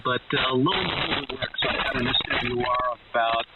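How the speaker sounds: ordinary talk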